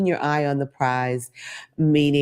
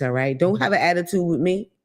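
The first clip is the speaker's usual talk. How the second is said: normal talking voice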